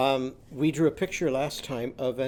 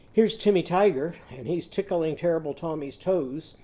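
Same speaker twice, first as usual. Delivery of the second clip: ordinary talk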